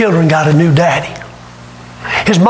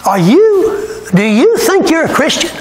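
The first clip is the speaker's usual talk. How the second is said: pointed question; 8 semitones higher than usual. Retold or imitated. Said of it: "here's my first question".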